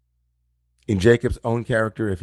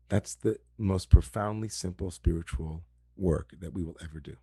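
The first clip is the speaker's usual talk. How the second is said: quiet reflection; 2 semitones lower, 6 dB quieter than usual. Acted.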